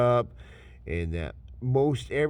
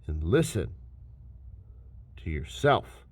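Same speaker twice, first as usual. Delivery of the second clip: ordinary talk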